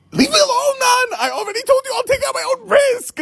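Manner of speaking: high yelling protest, near tears; hammed-up cry of leave me alone; shrieking at the pizza guy in mock despair; mock panicked yell. Acted driver yelling at restaurant.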